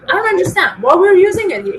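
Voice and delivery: mock frustrated boss complaint. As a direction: play the confused, annoyed director. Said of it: "He was frustrated".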